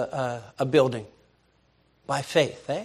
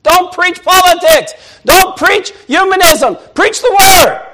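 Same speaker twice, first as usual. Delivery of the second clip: high, loud shouted plea; son's plea; 14 semitones higher, 11 dB louder, slower than usual. Retold or imitated. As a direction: shout it like the boy begging his father. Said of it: "one of my boys said".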